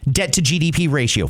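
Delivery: ordinary delivery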